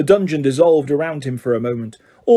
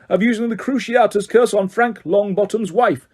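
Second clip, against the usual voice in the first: raised, higher forceful charges; bellowing judge; 6 semitones higher than usual. A narrator's reading. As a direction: hammer the accusations. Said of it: "bellowed Mister Crouch".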